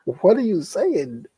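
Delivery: high, drawn-out mock bewilderment; baffled retort. Daughter answering dad's pig Latin, baffled retort. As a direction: stretch it out high, as if nothing made sense. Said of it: "I'm like".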